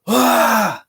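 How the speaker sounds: high, loud mock scream; retold scream. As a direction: yelp it high like waking in terror. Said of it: "I woke up and screamed".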